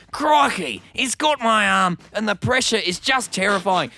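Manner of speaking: put-on high, loud, overexcited Australian accent, like a wildlife presenter; excited Australian impression. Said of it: "overly excited Austra-, like, in the way that only an Australian can".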